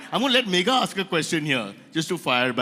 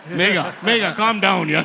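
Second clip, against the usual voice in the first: mock alarm; 7 dB louder than usual. Host mock-scolds the singing guest.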